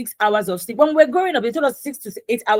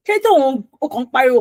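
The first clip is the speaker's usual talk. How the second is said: mock scolding; 5 semitones higher, 3 dB louder, faster than usual. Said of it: "people are telling you".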